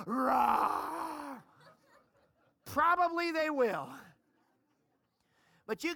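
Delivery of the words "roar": lion roar. Retold or imitated. Grown kids imagining their father roaring at them, mock lion roar. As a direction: bellow like a pretend lion. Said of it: "if I start going".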